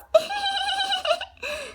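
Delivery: shrieking taunt laugh; high mocking laugh. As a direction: cackle at her. Stepsisters laughing.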